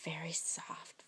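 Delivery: very soft breathy whisper. Shown as a demonstration. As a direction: whisper it very softly. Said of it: "Mister Brown can whisper".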